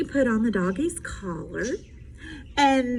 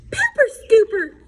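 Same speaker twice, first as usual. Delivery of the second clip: high, loud little sister squeal; little sister Polly squealing with glee; 9 semitones higher, 6 dB louder than usual. Acted. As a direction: squeal with delight. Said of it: "squealed Polly".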